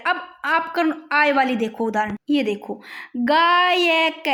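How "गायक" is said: "गायक" very slow, long first vowel; sounded out. Shown as a demonstration. Teacher showing the aay sound to students, "गायक" drawn out. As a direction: stretch "गायक" right out, gaa-yak. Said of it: "बस इसको बोल के देखना".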